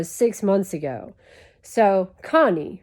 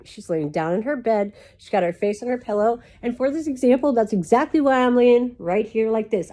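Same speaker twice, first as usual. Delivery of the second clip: usual speaking voice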